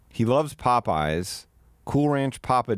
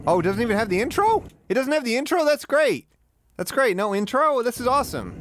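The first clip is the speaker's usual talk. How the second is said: excited; 12 semitones higher than usual. Real, not acted. Reacting to video with no intro.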